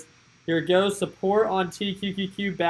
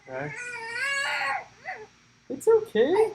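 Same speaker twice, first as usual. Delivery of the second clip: much higher sing-song reassurance; high soothing. Real, not acted. Dad comforts crying baby Remy in a very high soothing voice.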